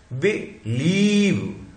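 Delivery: Believe drawn out slowly with the weight on लीव; stress on LIEV. Shown as a demonstration. Teacher to class, slow and stressed. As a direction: lean into the second syllable of believe. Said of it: "यहां पे आया है stress".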